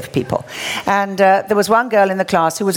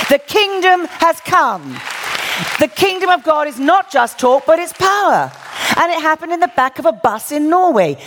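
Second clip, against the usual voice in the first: proclaiming; 9 semitones higher than usual. Real, not acted. Preacher proclaims the kingdom.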